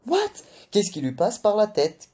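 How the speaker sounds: high, loud shocked outburst; outraged disbelief. Acted. Reviewer reacting to Nami offering Zeus a deal, shocked and loud. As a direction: blurt it out, stunned and indignant.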